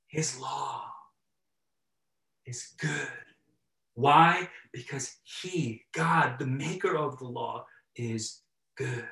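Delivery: soft, slow, reverent preaching voice; hushed sermon emphasis; quiet preaching. Acted. Preaching softly.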